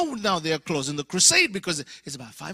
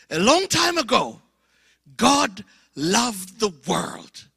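louder, higher, slow sermon voice; slow, loud sermon opening; preaching; 6 semitones higher, 10 dB louder, slower than usual. Retold or imitated. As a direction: preach it to the crowd. Preaching to classmates.

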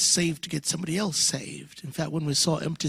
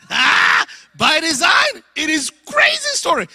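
much louder, higher exclamation; shout; 10 semitones higher, 12 dB louder than usual. Real, not acted. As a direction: belt it out laughing at the absurdity. Laughing outburst about the story.

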